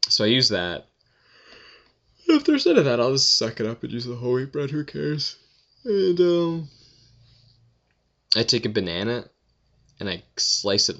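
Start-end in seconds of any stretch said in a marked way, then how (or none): 2.3-6.7 mock whiny. Shrugging off whole wheat bread.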